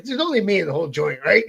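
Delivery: ordinary talk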